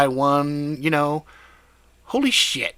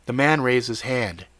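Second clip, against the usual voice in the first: ordinary delivery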